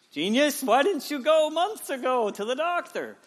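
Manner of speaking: high, loud, drawn-out mock yell; exasperated scolding. Wife to husband about his delayed doctor visit, exasperated high yell. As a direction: scold him loudly, sarcastic and high-pitched.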